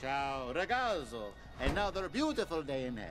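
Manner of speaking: put-on Italian accent. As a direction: gush like a charming Italian flatterer. Player voices Italian demon Roberto.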